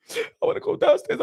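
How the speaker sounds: high, whining mock plea; whiny plea. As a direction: beg like a locked-out husband. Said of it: "He upstairs calling Remy".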